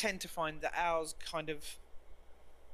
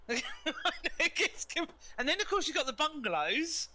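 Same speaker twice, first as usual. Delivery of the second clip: laughing; 10 semitones higher than usual. Real, not acted. Laughing while explaining British housing.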